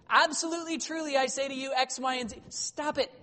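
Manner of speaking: high, exasperated nagging sibling; mock-annoyed relative telling Jesus to quit it; exasperated family member. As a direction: scold him like a fed-up brother. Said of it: "You can imagine his family being like".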